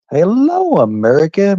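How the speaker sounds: high, loud greeting call; big hello. Real, not acted. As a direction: belt out a hello to the whole country. Calling out to the stream audience.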